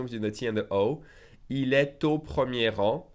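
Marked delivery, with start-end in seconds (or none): 1.5-3.1 slow, T linked to au; slow with liaison. Shown as a demonstration. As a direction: linger and link the T. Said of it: "Slowly, I'll make the liaison".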